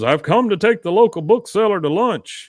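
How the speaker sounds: mock formal Hank. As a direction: announce it grandly. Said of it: "he goes".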